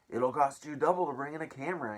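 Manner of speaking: high, loud shout at the reporters; greedy shout. Acted. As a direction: bark the price at the cameramen. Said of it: "Aunt Spiker shouted".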